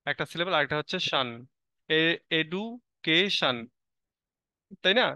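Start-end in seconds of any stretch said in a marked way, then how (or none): 2.3-3.6 "education" very slowly in three parts, এডু, কে, শন; syllable by syllable. Shown as a demonstration. Teacher showing students how a word splits, "education" said syllable by syllable. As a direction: break "education" into separate syllables with clear gaps. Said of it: "syllable অনুযায়ী আমরা বড় ওয়ার্ডগুলোকে ভেঙে ভেঙে উচ্চারণ করে থাকি".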